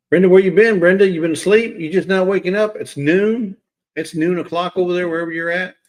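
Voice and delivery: loud greeting. Real, not acted. Host calling out to viewer Brenda who slept late, loud and teasing.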